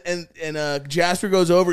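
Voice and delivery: usual speaking voice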